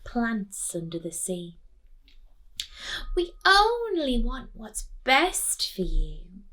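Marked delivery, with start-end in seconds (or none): 3.1-6.4 high, slow, sugary reassurance; slow high other mother reassuring. Acted. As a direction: soothe her slowly, too sweetly.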